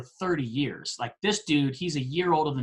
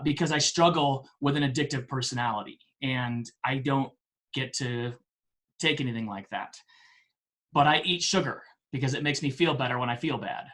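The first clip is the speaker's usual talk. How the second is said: usual speaking voice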